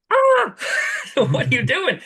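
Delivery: high-pitched comic yelp breaking into laughter; mock horrified outcry. Mechanic reacting to customer's odd wiring, mock horrified outcry.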